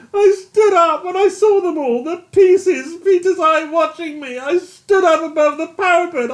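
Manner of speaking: high, loud tearful anguish; a broken man begging to go home; weeping despair. A narrator's reading. Yates weeping confession.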